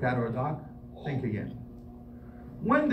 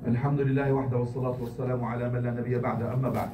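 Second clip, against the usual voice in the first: fast, low formulaic recitation; formal Arabic khutba formula; 5 semitones lower, 6 dB quieter, much faster than usual. Acted. Imam closing and reopening the sermon, formulaic recitation. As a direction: rush through the ritual formula.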